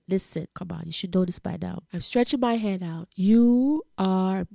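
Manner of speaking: ordinary delivery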